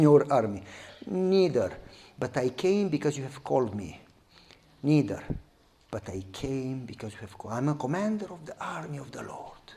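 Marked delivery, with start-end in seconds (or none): 1.1-7.5 low, soft, fading answer; calm low God. Acted. God answers Joshua, low and soft. 7.5-9.7 a low whisper. Acted. God reveals himself to Joshua in a whisper.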